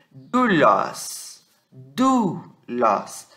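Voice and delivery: "Doulos" as DOO-los, long oo, open o; Greek pronunciation model. Shown as a demonstration. Teacher showing students the Greek word, "Doulos" said DOO-los. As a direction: draw out the "ou" of "Doulos" as one oo. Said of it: "você vai falar doulos".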